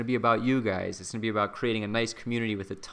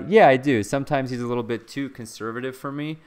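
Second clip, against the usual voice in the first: normal talking voice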